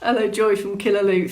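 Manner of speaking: ordinary delivery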